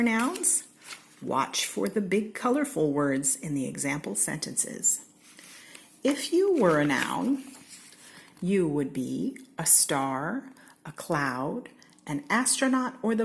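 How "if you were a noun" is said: refrain. A narrator's reading. Recurring refrain read aloud.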